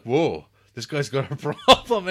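a friend mimicking a confused customer, laughing; laughing imitation. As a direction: imitate a startled stranger, laughing through it. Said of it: "they think like".

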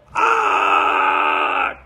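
long drawn-out scream of a fall. Acted. Climber falling from the mountain, long scream. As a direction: scream like someone plummeting.

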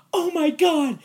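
very high, loud startled gasp; mock shriek. Girl spotting the crawling actor, high startled shriek. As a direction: squeal in surprise.

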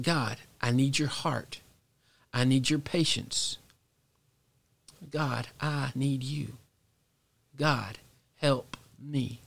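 softly, slowing down with long pauses; a hushed, slow plea to God; quiet pleading prayer. Retold or imitated. Father asking God for patience with his kids, quiet pleading.

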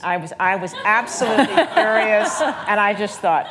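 real laughter breaking through the words; laughing. Real, not acted. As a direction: laugh your way through remembering the anger. Laughing while recalling fury.

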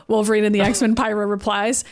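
ordinary delivery

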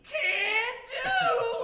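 eager high-pitched yes; Mister Meeseeks. Acted. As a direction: shout it like a thrilled servant. Meeseeks answers player request.

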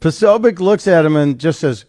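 ordinary delivery

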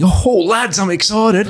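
high, hammy excitement without feeling; fake excited scream. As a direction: squeal it like a hollow put-on thrill. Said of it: "just screaming".

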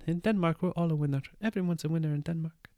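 high, soft mimicking voice; hammed-up egalitarian Dane, high and softly voiced; mock Danish voice. Mimicking the equally clapping Danes.

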